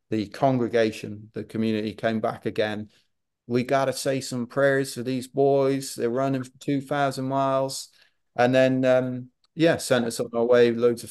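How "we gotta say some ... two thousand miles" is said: put-on Tennessee accent. Tennessee church folk blessing the runners, in a Southern accent. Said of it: "when you did your accent".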